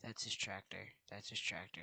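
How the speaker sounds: ordinary talk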